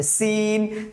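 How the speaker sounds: "সিন" hissed thinly, vowel held; thin, fine S. Shown as a demonstration. Teacher repeating the letter, thin drawn-out "সিন". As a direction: stretch "সিন" out on a narrow s. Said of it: "চিকন করে আওয়াজ হবে।".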